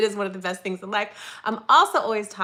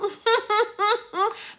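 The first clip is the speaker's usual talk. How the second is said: high bright burst of laughter; delighted laugh; 11 semitones higher than usual. Real, not acted. Delighted at subscriber count.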